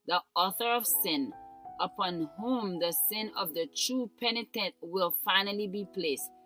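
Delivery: ordinary talk, nothing marked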